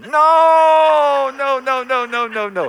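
sudden loud, high no; emphatic shout. Real, not acted. Pastor rebuking the crowd, sharp shouted no. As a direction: shout it down hard. Pastor rejects little vision.

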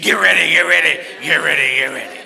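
loud, fading preacher's repeated call, first cry the loudest; T D Jakes. Retold or imitated. Pastor quoting a famous preacher, loud booming call. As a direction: boom it out, then let it trail off. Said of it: "that's why T D Jakes said".

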